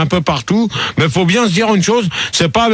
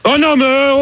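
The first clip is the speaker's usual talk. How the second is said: high-pitched exasperated objection; angry protest; 7 semitones higher than usual. Real, not acted. Host protesting in the row.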